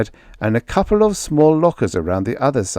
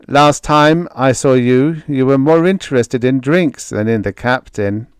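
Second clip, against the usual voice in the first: sardonic crewman; 5 semitones higher, 6 dB louder than usual. A narrator's reading. Storeroom man mocks Greg, sardonic. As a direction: rub his drinking in.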